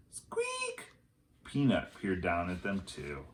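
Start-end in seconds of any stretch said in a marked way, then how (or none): 0.1-0.9 tiny high squeak; high mouse squeak. A narrator's reading.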